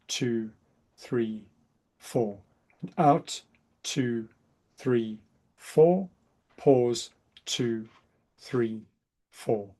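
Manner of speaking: soothing breath coach counting; guided breathing count. Shown as a demonstration. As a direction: count them calmly through the breath. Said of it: "I'm gonna count you through".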